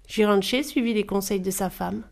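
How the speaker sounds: ordinary delivery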